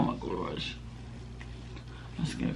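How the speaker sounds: muffled by rubbing hand; speaking while massaging face; hand over mouth. Real, not acted. Seen 1: Rubs moisturizer into face; hand covers mouth at 0.6-1.1 and 3.0.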